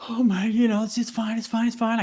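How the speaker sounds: high, teary self-soothing, played for laughs; mock holding back tears. Young salesman turning away from the door, mock tearful. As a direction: imitate choking back tears. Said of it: "the tears are just intensifying".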